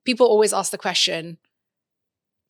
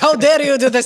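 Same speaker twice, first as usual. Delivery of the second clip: higher, louder mock indignation; mock outrage; 6 semitones higher, 9 dB louder, faster than usual. Imagining Emiratis coming for them, mock outraged accent. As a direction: play the offended Emirati, hammed up. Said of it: "they're just gonna be like".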